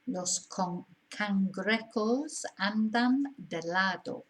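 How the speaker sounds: slow, deliberate second reading; slow repeat. Shown as a demonstration. Teacher repeating the exercise sentence, slowly and clearly. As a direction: say it again slowly so they catch it. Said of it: "repeats sentence for the learner".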